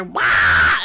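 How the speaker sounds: loud low squawk like an Australian bird; deep bellowed bird squawk; bird call imitation. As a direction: blurt out a harsh bird cry. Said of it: "birds that go".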